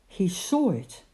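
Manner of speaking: usual speaking voice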